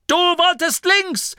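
high, slow protesting shout; reproachful. A narrator's reading. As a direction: throw the blame back indignantly. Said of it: "sagte der Signalgeber vorwurfsvoll".

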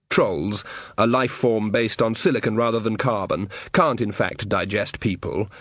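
plain reading voice

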